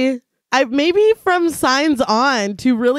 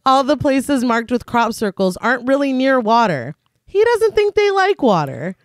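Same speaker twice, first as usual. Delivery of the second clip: measured read-aloud film summary; scripted recap narration; 4 semitones lower than usual. A narrator's reading. Podcast host reads the film's plot to co-hosts, measured narration. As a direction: narrate the plot evenly, sentences falling at the ends.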